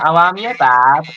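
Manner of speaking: slow, drawn-out Brummie demo; Brummie accent. Shown as a demonstration. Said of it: "the Brummie accent where they talk slow".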